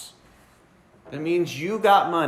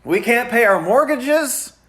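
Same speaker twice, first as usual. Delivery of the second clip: mildly whining, higher voice; whiny complaint; 6 semitones higher, 3 dB louder than usual. Retold or imitated. Debtors whining about mortgages, a touch higher and louder. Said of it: "they're crying and complaining".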